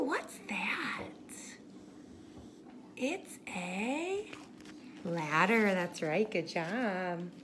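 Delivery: hushed whisper. Real, not acted. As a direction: whisper it like a secret. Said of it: "whispers to child listener".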